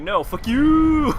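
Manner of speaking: high, loud, drawn-out boss imitation; long, extended curse. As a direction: stretch YOU into a soaring mock wail. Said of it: "the long extended".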